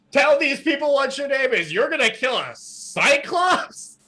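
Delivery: high, delighted announcing to a crowd; showman's excitement. Acted. Costa showing the girl off to the crowd, high and excited. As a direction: present her like a prize fighter.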